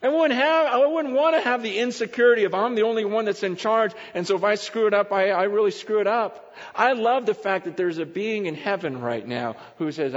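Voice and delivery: ordinary talk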